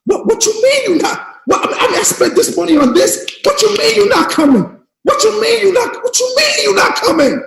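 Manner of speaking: reliving the phone call, stunned and pleading; shocked disbelief. Retold or imitated. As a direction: protest in disbelief that he's not coming. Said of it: "I was like this on the phone".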